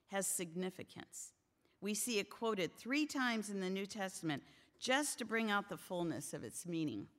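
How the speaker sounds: ordinary talk, nothing marked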